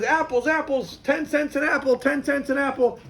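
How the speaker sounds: high vendor's cry, lightly projected; street vendor calling. Retold or imitated. Peddler announces apples to the crowd, calling out. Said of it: "And he announces".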